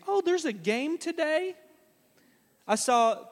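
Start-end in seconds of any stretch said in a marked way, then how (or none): 0.1-1.6 much higher mock-surprised question; clueless mock surprise. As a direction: play dumb, pitch leaping up in fake surprise.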